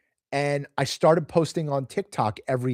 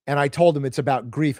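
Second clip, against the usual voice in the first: ordinary talk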